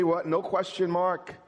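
normal talking voice

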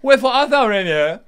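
high, loud posh British lament; ultra posh Shakespearean. Acted. Presenter playing Juliet for laughs, ultra-posh. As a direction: declaim it like a posh stage Juliet. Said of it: "like, ultra posh".

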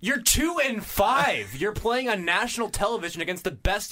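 exasperated. Real, not acted. Host scolding the absent coach, exasperated. Cuts off clip to rant at coach.

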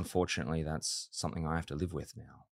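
soft, low mock regret; deadpan sarcasm. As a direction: lament it quietly, tongue in cheek. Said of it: "obviously it was sarcastic".

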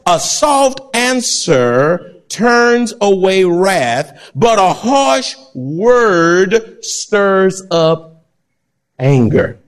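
drawn-out, very slow reading of the verse; hushed, deliberate scripture quote. Shown as a demonstration. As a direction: read the proverb slowly, savoring each word. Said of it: "Proverbs fifteen one says".

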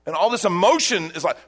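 ordinary delivery

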